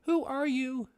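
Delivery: high-pitched story character; Rory. Acted. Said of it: "I'm gonna do Rory's voice".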